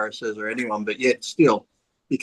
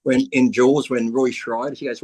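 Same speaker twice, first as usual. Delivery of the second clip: usual speaking voice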